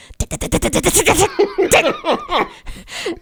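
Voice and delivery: rapid high-pitched "tat-tat-tat" keyboard noise; imitated angry typing. Co-host showing listeners his laptop typing, imitated angry keyboard clatter. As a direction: mimic furious typing in quick staccato clicks.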